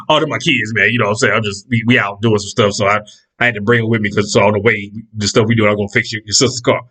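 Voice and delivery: low, offhand excuse; lightly voiced mechanic. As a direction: shrug it off casually.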